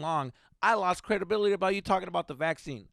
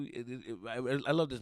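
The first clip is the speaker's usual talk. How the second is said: usual speaking voice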